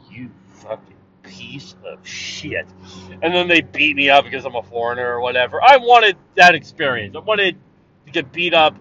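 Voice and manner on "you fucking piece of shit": menacing insult. Retold or imitated. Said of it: "They're like".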